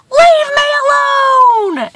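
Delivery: high, drawn-out holler; long high shout to drive someone off; defiant scream. A narrator's reading. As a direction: yell it across the valley. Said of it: "so he screams".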